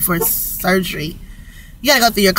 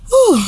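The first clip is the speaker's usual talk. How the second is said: a high, loud breath of release; real overwhelmed exhale; high relieved whew; 12 semitones higher, 4 dB louder than usual. Real, not acted. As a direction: let the joy out in one breath.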